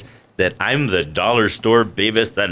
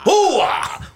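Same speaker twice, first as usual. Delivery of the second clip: Pacino hoo-ah. Shown as a demonstration. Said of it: "Al Pacino".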